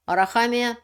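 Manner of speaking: normal talking voice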